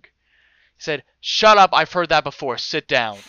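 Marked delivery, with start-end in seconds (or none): 1.2-3.2 angry dean. Retold or imitated. Dean silences a pleading student, angrily. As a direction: cut him off with a raised voice.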